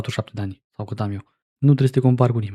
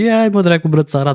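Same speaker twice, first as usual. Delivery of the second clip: higher and louder, fed up; exasperated outburst; 6 semitones higher, 6 dB louder than usual. Real, not acted. Commentator fed up with the vlogger filming the crying girl, loud outburst. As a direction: blurt it out in disgust.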